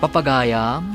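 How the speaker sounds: ordinary delivery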